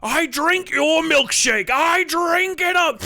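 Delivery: high, loud, drawn-out gloating roar; milkshake villain. Acted. Oilman taunting Eli in There Will Be Blood, gloating shout. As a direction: stretch each word out in a booming theatrical cry. Shouting famous movie line in character.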